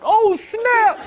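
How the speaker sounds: high, loud burst of shock; surprised exclaim. Retold or imitated. Teen self watching the show, shocked outburst. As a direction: blurt it out like you just saw drama unfold. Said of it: "I was like".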